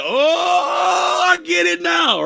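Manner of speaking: high, loud shout of getting it; sudden realisation. Retold or imitated. Streamer voicing viewers suddenly understanding the list, high shout. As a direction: blurt out the revelation. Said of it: "you're gonna look back at that list and be like".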